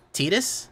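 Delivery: Titus as TEE-dus, rising high in disbelief; questioned pronunciation. Real, not acted. Doubting viewers' correction, Titus as a high TEE-dus question. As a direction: query Titus with an "ee", pitch leaping up. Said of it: "everyone gets mad at me for pronouncing".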